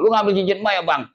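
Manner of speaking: high-pitched accusing sibling; sibling confronting. Retold or imitated. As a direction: confront the brother sharply.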